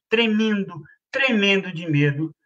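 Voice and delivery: ordinary delivery, nothing marked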